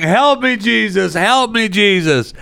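imitating a frantic woman yelling for help; retold yelling. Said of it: "and she's yelling".

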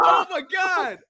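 high shouted oh my God; exasperated shout. Real, not acted. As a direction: cry out in mock agony. Shout after the vote shock.

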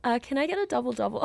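American accent. Shown as a demonstration. Australian guest ordering at drive-thru, American accent. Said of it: "when my American accent comes out".

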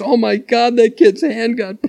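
high-pitched mock gasp of shock; imitated horror. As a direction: bystanders aping horror. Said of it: "people are looking in horror off the bus".

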